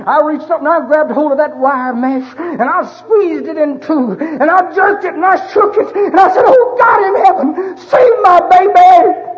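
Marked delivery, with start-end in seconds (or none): 0.6-6.4 rising preacher's fervour. Real, not acted. Preacher reliving gripping the wire, fervour rising. Sermon climax, reliving the moment. 6.5-9.3 anguished prayer, much higher and louder, slow; desperate cry to God. Retold or imitated. Father crying out to God to save his baby, anguished.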